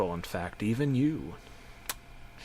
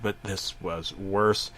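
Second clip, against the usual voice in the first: ordinary talk, nothing marked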